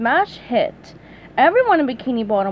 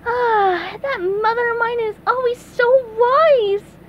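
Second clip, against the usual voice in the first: high, choked-up cartoon sponge; SpongeBob voice; 12 semitones higher, 3 dB louder than usual. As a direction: gush with emotion. SpongeBob moved by note.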